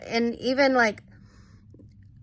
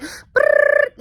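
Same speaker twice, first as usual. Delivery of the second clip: very high, loud lip trill "Brrr"; loud eye flutter trill; 9 dB louder than usual. Retold or imitated. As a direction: blast out a high buzzing trill. Eyes fluttering sensation imitated.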